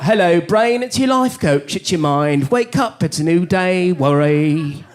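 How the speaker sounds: chirpy life coach in a put-on English accent. Mind waking the brain as life coach, put-on English accent. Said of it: "How come you have an English accent".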